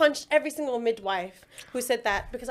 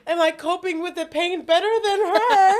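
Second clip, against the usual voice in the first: high, loud mock plea; overexcited retold demand; retold desperate question; 7 semitones higher, 8 dB louder than usual. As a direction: call out to mum, hammed-up. Retold asking mum while high.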